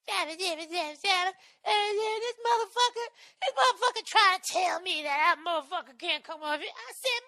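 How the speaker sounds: high, shrill-free falsetto street woman cursing; loud cleaning lady impression. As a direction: belt it out as a loud housekeeper. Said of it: "I just hear this lady telling this story".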